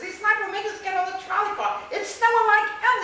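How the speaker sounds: loud high protest. Retold or imitated. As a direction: protest loudly, pitched high. Friend protests in snowstorm.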